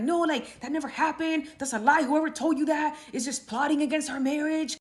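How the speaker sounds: high, flustered pleading; panicked rambling husband. Retold or imitated. Caught husband pleading with his wife, high and flustered. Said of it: "He was spiraling".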